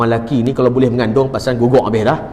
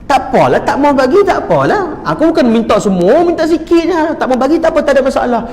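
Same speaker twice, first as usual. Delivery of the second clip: retold huffy protest; 10 semitones higher than usual. Husband rebuffed at neighbour's door.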